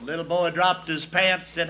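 usual speaking voice

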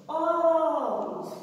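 Óculos with Ó high, loud and long; stress on first syllable. Shown as a demonstration. Teacher showing the acute accent on O, Ó drawn out. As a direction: stretch the open "Ó" of "Óculos". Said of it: "acento agudo no O".

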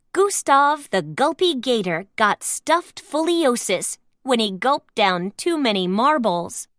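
ordinary delivery

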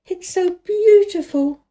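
put-on high, slow, breathless girl's voice; breathless delight. A narrator's reading. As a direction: gasp it out, thrilled. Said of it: "a little breathless with her speed".

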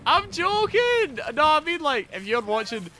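loud, high-pitched protest; excited shouting. Real, not acted. Streamer telling Helen it was a joke, excited shouting. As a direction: keep shouting, high and insistent. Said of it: "I'm sorry for shouting".

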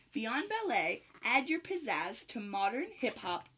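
lively, sing-song picture-book read; bouncy rhyming storybook voice; reading to children. Acted. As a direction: read it like storytime for little dancers. Reads picture book to kids.

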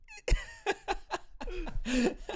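helpless squeaky laughter; high squealing laugh. Real, not acted. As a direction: crack up high.

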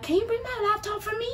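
high mock sweet request; Nana asking. Imitating Nana asking for her laptop from bed, high sweet mock. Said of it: "Nana could be like".

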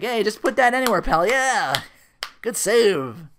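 high, loud jeering call; mock heckling. Diner customer heckling after dropped dishes, loud and jeering. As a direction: call it out in teasing approval.

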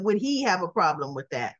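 "he" leaned on with a falling tune; mock-puzzled, weighing "he"; stress on he. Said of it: "Something he said".

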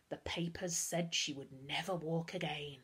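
hushed, dramatic stage whisper. A narrator's reading. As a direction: whisper it like a grave secret. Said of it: "high-pitched voice dipped into dramatic whisper".

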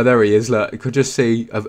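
ordinary talk

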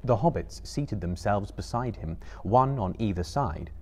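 ordinary delivery, nothing marked

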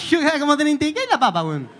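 normal talking voice

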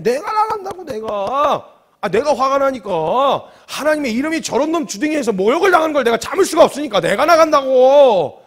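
high, loud indignant protest; worked-up shouting. Acted. David insisting to onlookers he will go, worked-up shouting. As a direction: insist at full voice, stretching the last word. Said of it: "이러면서 막 흥분을 하기 시작했던 거예요".

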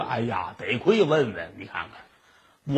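low, soft mock dismay; storyteller's deflated, near-tearful shopkeeper; nearly crying. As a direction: sink into a sighing lament. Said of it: "掌柜的坐这儿差点没哭了".

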